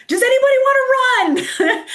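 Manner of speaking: calling out for volunteers. Retold or imitated. Pacer calls to runners at the aid station, shouting. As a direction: call out high to a whole crowd.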